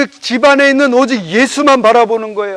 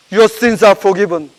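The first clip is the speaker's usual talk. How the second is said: slow solemn quote; 3 semitones lower, much slower than usual. Acted. Jesus's words quoted in English.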